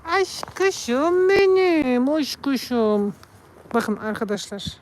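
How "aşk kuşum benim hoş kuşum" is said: cooing pet talk. Real, not acted. Cooing at the cat. (kediye yüksek ve yavaş bebek sesiyle sevgi)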